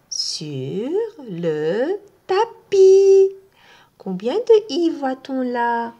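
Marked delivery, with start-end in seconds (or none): 0.1-3.3 "tapis" drawn out high; slow, loud finish. Shown as a demonstration. Teacher ends the chant line for learners. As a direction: land it big and slow. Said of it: "after pi chant".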